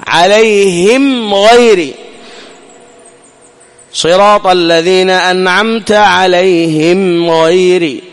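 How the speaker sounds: صراط الذين أنعمت عليهم غيري drawn out, the meem briefly closed; slow Quran recitation. Shown as a demonstration. Teacher recites the Fatiha line slowly for students. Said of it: "في الميم المظهرة".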